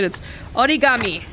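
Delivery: origami said the Japanese way, "odigami"; Japanese pronunciation. Shown as a demonstration. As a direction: origami with a light tapped r, o-ri-ga-mi. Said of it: "That's how the Japanese pronounce it".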